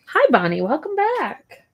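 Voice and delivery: high, swooping pet-talk voice; dog-greeting voice. Real, not acted. Owner greets her dog Bonnie coming back, high pet-talk voice.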